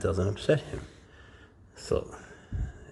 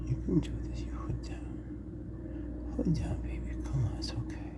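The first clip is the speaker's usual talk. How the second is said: tender coaxing whisper; gentle whispered reassurance to snake; soothing whisper; 8 semitones higher, 10 dB quieter than usual. Real, not acted. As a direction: whisper to calm a nervous pet.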